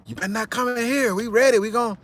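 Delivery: high, mock chest-beating threat; mock tough talk. A leader taunting his enemies, mock tough threat. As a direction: puff up like a tough guy daring them. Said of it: "beat on his chest like".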